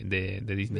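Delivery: low, quiet cartoon villain voice; voz de villano de Disney. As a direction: growl it like a Disney baddie. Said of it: "con voz de villano de Disney". (voz grave de villano de caricatura)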